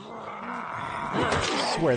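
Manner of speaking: sudden high exasperated shout; exasperated outburst. Real, not acted. Exasperated rant about fake NPC looting.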